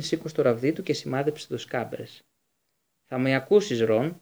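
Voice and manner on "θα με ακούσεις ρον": plain reading voice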